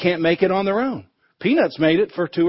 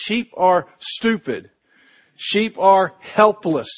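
ordinary talk, nothing marked

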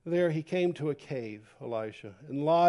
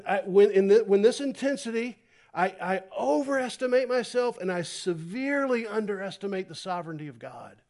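ordinary delivery

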